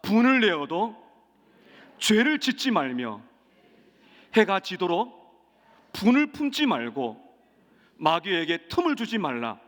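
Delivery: loud verse recitation, phrase by phrase. Shown as a demonstration. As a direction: lead the congregation with forceful phrases and pauses for repeating. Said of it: "한번 따라 하십시다". (힘주어 끊어 읽는 성경 구절 선창)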